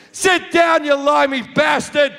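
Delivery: high, acted shout of abuse; angry heckle. Guy throwing peanuts at his head, angry heckle. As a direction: imitate an outraged passenger yelling an insult.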